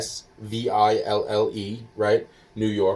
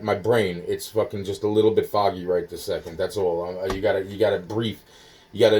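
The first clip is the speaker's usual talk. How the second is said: ordinary delivery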